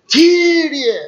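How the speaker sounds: loud, high cry of "Κύριε"; desperate cry to God. Retold or imitated. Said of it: "πήγα και φώναξα δυνατά".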